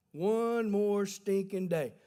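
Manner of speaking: slow, emphatic refrain of the punchline. Real, not acted. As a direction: drag it out slowly. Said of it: "punchline refrain".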